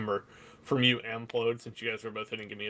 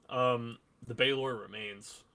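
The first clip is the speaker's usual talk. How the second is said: ordinary talk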